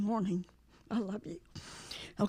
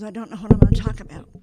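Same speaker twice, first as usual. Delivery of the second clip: mock-worried husband, rushed and louder; fretting husband; 10 dB louder, much faster than usual. Wife imitating her husband fretting over his talk, rushed. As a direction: blurt it out fast like a nervous speaker.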